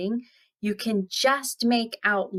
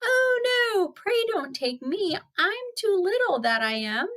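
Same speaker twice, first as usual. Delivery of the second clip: very high, small goat voice; pleading little goat; 13 semitones higher than usual. Acted. Youngest goat begging the troll to spare him, small and high. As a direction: plead in a tiny high-pitched voice.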